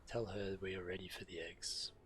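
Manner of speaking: ordinary talk, nothing marked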